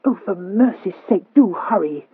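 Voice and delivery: put-on impatient, cross girl's plea. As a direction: snap at them to hurry. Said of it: "replied Horatio crossly".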